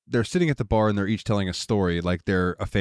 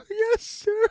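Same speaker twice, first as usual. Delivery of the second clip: very high, tiny mock girl voice; meek Lucy. Lucy answering Peter after the punch, in a tiny squeaky voice. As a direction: squeak out a cowed little yes. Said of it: "And she's like".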